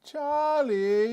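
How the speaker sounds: put-on high, loud British child whine; British kid. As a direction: stretch it out like an aggrieved British toddler. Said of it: "I think it's a British kid though".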